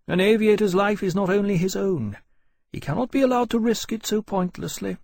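high-pitched, emphatic character voice; firm officer. A narrator's reading. Captain telling Lawrence aviators cannot risk themselves, firm. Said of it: "Portland said flatly".

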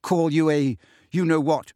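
mildly indignant protest; protest, taken aback; yelping protest. A narrator's reading. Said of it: "yelped James".

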